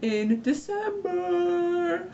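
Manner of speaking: high, drawn-out announcement; slow excited build-up to big news; really excited. Real, not acted. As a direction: stretch it out in a high, gleeful squeal. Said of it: "I'm, like, really excited".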